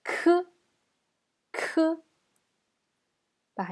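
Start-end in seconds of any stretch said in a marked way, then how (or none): 0.0-1.8 "K" as "kuh" with a strong puff of air; aspirated K. Shown as a demonstration. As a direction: release "K" with more air than a G. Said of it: "mais plus d'air".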